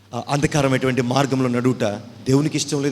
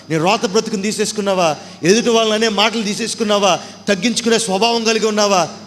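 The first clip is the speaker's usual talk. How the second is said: each question louder and higher; building sermon questions; 7 semitones higher, 5 dB louder than usual. Acted. Preacher rebuking the flock, rising to a shout. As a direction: climb with every question.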